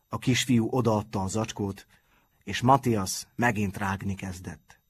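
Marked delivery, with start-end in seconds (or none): none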